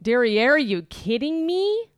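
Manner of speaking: Derriere stretched, you kidding me? drawn out slow with a rise; milk the pun Derriere, draw it out slowly; spelling out the pun slowly; pun emphasis. Shown as a demonstration. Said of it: "So it's a pun on derriere".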